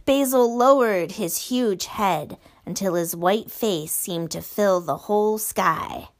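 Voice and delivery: plain reading voice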